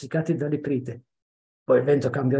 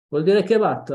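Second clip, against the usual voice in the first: jeering shout; 6 semitones higher, 7 dB louder than usual. Acted. Child taunting about madness.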